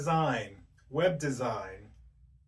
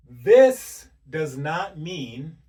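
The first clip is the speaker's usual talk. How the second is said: This does not mean, every word slow and much louder; slow emphatic stress; 2 semitones higher, 12 dB louder, much slower than usual. Real, not acted. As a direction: lean hard on This does not mean, drawn out. Stressing the point, slowly.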